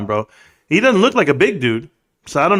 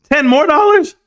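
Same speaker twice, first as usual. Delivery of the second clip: shocked rising question, much higher; surprised; 11 semitones higher, 4 dB louder than usual. Real, not acted. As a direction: react in disbelief. Surprise at donation.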